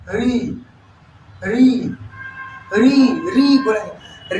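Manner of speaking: री said slowly and repeated; slow री demo. Shown as a demonstration. Teacher modelling the sound री. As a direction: draw out री slowly. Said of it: "एक होता है आपका छूट गया री".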